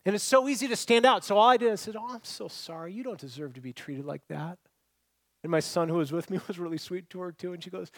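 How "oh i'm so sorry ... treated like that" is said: soft, consoling tone; sympathetic. Retold or imitated. Kind customer comforting a mistreated worker, sympathetic. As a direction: console gently, much quieter.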